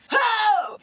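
high, loud "Ho!" on a burst of air; blasted ho. Shown as a demonstration. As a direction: fling it out from the diaphragm. Demo of breathy blast onset.

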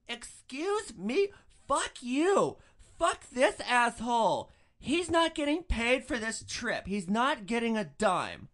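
slow, high, loud indignant shrieking; outraged drunk. Retold or imitated. Drunk passenger telling the driver he won't be paid, slow, loud and high. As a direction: drag out every word in drunken outrage.

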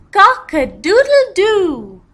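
high, loud crowing cock-a-doodle-doo; rooster crow. Shown as a demonstration. Teacher showing kids a rooster, loud high crowing. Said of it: "A cock says".

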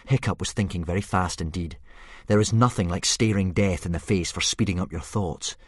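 ordinary delivery, nothing marked

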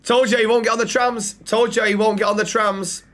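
loud, high gloating repeat. Real, not acted. Streamer gloating to chat that friend won't ride trams, loud and high.

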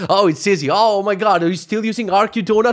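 mocking playground kid. Schoolkid mocks a classmate still using Arc, loud and jeering. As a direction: taunt like a snotty schoolkid showing off. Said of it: "on the playground is gonna be like".

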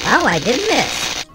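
usual speaking voice

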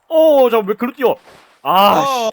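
sudden loud alarm; startled outburst. Real, not acted. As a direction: yelp of surprise. Game play goes wrong.

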